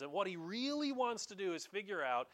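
ordinary talk, nothing marked

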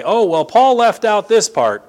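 preacher parodying those who would second-guess Paul; mocking critic voice. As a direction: lift the voice to mock a critic's objection. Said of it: "other people come along behind me and say".

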